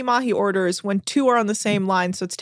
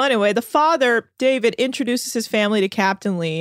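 ordinary talk, nothing marked

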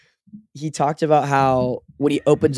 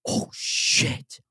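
hushed unvoiced oh shit; whispered panic; 6 dB quieter than usual. Panic on realizing forgot speech.

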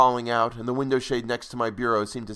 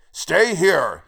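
loud, raised-pitch command; yelled order; 6 semitones higher, 6 dB louder than usual. A narrator's reading. Grandfather ordering the child on the landing, yelled. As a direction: shout a firm order at a child. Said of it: "he yelled at me".

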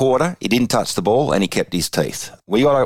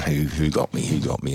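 softer, seething under his breath; fuming to himself; 4 semitones lower, 5 dB quieter than usual. Retold or imitated. Fuming after copping one to the snoz, seething. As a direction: stew on it quietly, furious inside. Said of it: "I was just fuming".

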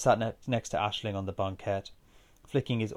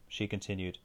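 usual speaking voice

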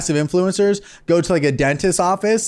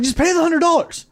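mock commenter; 11 semitones higher than usual. Mocking commenters.

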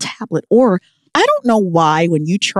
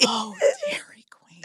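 swooning whisper; 11 dB quieter than usual. Acted. Swooning over Dairy Queen.

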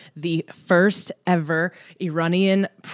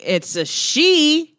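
pointed correction; 9 semitones higher, 4 dB louder, much slower than usual. Retold or imitated. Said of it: "she's like".

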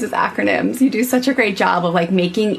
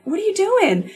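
high curious mom voice; 12 semitones higher than usual. Retold or imitated. Mom's question voiced in story.

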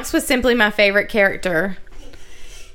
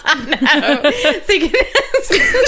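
talking through giggles; words breaking into laughter; laughing; 9 semitones higher, much slower than usual. Real, not acted. Laughing while speaking.

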